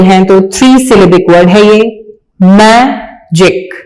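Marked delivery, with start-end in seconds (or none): 2.4-3.7 मैजिक said slowly as mai-jik; slow syllables. Shown as a demonstration. Teacher showing a two-syllable word to pupils, slow. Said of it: "डाइसिलेबिक".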